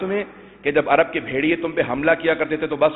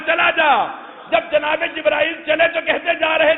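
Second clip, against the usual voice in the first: high-pitched fervent sermon delivery, building; impassioned preaching; 13 semitones higher than usual. Acted. Preacher builds toward the Zulfiqar moment, fervent and high. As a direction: drive the story upward toward the climax.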